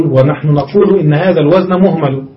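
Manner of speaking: ordinary delivery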